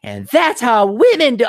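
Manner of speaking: high, loud sneering parody of a movie line; mock the line in a put-on voice; mocking quote. Ranting vlogger mocks a line he hates, sneering high. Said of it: "I don't wanna even hear".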